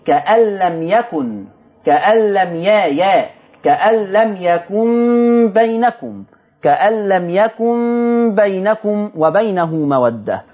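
very slow chanted recitation with ya in يكن; Quranic recitation. Shown as a demonstration. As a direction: recite it with a clear "ya" in يكن, drawn out. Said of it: "فقرأ بياء التذكير". (تلاوة بطيئة بياء التذكير في يكن)

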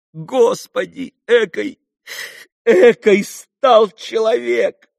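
high, slow, loud lament; appalled exclamation. A narrator's reading. Grushenka sees what he has become, appalled. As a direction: cry out in shame at what he became. Heroine appalled by former lover.

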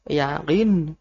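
"yakin" with a back-of-throat q instead of k; "yakin" in Arabic style with qaf; Arabic qaf pronunciation. Shown as a demonstration. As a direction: say "yakin" as ya-qiin, long final vowel, falling. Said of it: "yakin pakai qaf".

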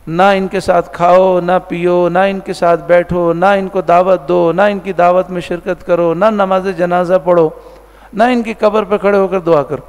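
ordinary delivery

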